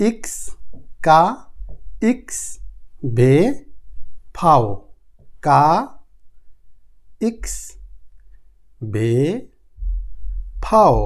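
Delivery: spaced-out recap of "কা", "ইক্স", "বে", "ফাও", one letter at a time; German letter drill. Shown as a demonstration. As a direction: recite "ইক্স", "কা", "বে", "ফাও" as iks, kaa, veh, fow. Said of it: "তো আমরা দেখলাম".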